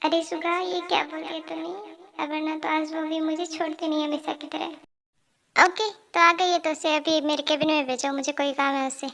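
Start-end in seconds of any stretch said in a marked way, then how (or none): 0.0-5.6 soft talk to oneself; hushed self-reproach; worried aside. Acted. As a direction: say it under your breath, privately.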